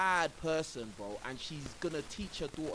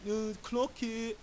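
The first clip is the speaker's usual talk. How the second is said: mocking trendy girl; 6 semitones higher, slower than usual. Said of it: "They're doing that".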